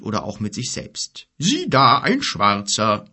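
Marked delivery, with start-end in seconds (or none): 1.4-3.0 slow, half to himself; lightly voiced friendly giant; musing giant. A narrator's reading. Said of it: "grollte er in einem Tonfall, als spreche er mit einem guten Freund".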